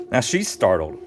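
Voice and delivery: ordinary delivery, nothing marked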